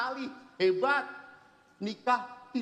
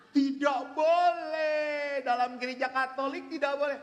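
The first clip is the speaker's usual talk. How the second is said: teriakan menolak; 6 semitones higher than usual. Retold or imitated. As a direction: bark the refusal at the husband. Istri menolak suami kawin lagi. (berteriak menolak dengan marah, lebih tinggi)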